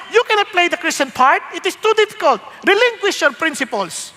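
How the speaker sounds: high, taunting tempter voice; voice of cowardice. Acted. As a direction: urge him to give up his faith. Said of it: "Sabi niya". (mapanuksong boses ng tukso)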